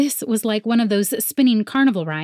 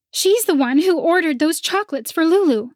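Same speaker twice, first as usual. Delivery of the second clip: accusing rival voice; 10 semitones higher, 4 dB louder than usual. A narrator's reading. Penelope points at Dee Dee.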